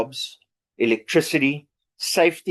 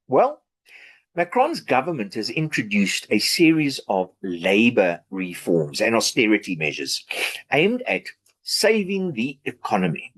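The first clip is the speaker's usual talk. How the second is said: normal talking voice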